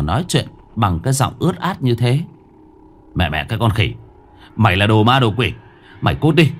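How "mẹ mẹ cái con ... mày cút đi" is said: louder, higher angry yelling; furious shout. Acted. Thượng screaming threats at the ghost at the door. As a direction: drive the ghost away with rage.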